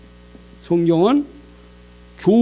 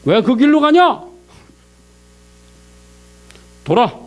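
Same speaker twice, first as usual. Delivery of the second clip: God rebuking; 3 semitones higher, 4 dB louder than usual. Retold or imitated. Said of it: "하나님이 책망을 하세요".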